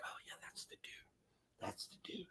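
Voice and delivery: imitated hallway gossip whispers; whispering. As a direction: whisper it furtively, as passing schoolmates would. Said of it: "I heard the whispers".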